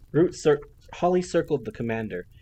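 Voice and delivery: plain reading voice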